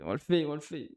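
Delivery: ordinary talk, nothing marked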